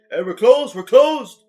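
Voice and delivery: loud, high, mock-bitchy shout. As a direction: imitate a rude worker turning customers away. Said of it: "people are usually real bitchy".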